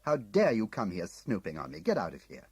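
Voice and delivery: loud outraged order to leave; angry shout. Acted. Tim ordering Munslow off, angry shout. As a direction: drive him off the hill. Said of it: "I shouted at him".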